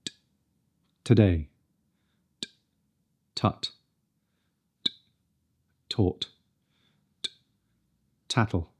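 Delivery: "T, today", "tut", "tattle" with a clean t; crisp t. Shown as a demonstration. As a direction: tip of tongue, crisp release. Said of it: "Nice and crisp".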